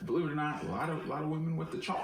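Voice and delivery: lightly put-on rural Ohio twang, quick and laughing; mock rural accent. Mocking a friend's story in a rural accent, laughing. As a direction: tease a friend in a hammed-up local accent. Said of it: "fun to say in that accent".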